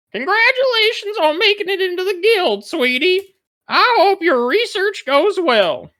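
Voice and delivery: very high, loud mama voice, congratulating; Mama Betty. Acted. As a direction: call it back in a piercing put-on falsetto. Mama Betty congratulates over her shoulder.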